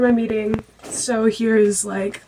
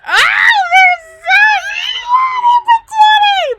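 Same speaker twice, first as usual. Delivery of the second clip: excited squeal; 5 dB louder, much slower than usual. Real, not acted. Squealing over a cute couple.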